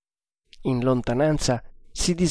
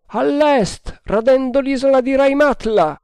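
high, carrying voice; shouted report; 12 semitones higher, 4 dB louder than usual. A narrator's reading. Lookout reporting the frigate's course, shouting. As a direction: call the bearing down from aloft.

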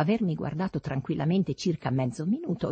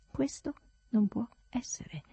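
soft, higher, deliberate reply; exaggerated self-assurance; 6 semitones higher, 5 dB quieter than usual. A narrator's reading. Servant refuses his raging master, exaggeratedly self-assured. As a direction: answer softly, supremely sure of yourself. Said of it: "con una esagerata sicurezza di sé".

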